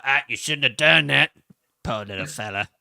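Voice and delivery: a hint of Cartman, low and pitying; lightly voiced Cartman. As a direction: Cartman lightly sighing over the poor fella. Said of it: "And he's just like".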